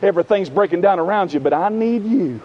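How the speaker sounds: soft, low, tender appeal; gentle invitation. Acted. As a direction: draw the weary listener aside quietly. Voices Jesus gently inviting the weary.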